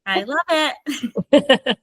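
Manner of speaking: slow, laughing through the words. Real, not acted. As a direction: laugh it out slowly. Laughing while closing the interview.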